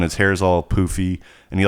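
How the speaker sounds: usual speaking voice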